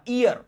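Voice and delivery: ईयर said with no H sound, starting on the vowel; silent H. Shown as a demonstration. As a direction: say ईयर with the opening H left silent. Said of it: "इसको ईयर pronounce करना है".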